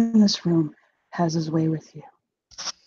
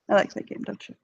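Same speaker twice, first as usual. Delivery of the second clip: menacing whisper; 8 dB quieter, much faster than usual. Acted. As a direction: whisper it playfully cruel. Villain teases Isaac.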